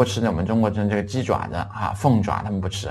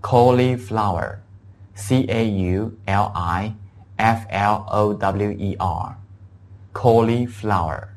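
each letter of cauliflower drawn out slowly; slow spelling demo; much slower than usual. Shown as a demonstration. Teacher spells cauliflower slowly for the class. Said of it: "叫cauliflower".

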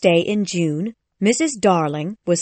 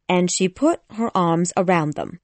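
ordinary delivery, nothing marked

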